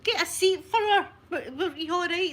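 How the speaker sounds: high, loud fussing over someone hurt; flustered concern. Retold or imitated. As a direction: rush in with loud, high-pitched sympathy. Fussing over hurt old lady.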